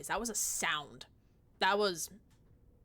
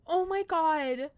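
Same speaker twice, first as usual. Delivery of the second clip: louder delighted oh my god; a bit louder and slower, thrilled; excited gush; 6 semitones higher, 6 dB louder, slower than usual. Real, not acted. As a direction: stretch out the oh with delight. Cooing over a hamster.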